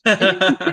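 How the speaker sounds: loud burst of laughter. Real, not acted. Laughs at retold moment.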